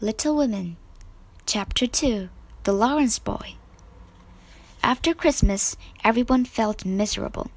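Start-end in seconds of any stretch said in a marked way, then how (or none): none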